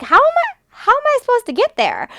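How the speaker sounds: high incredulous disbelief. Retold or imitated. To the driver who stranded her car, high incredulous disbelief. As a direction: squeal it out in disbelief. Exasperated quote to delivery driver.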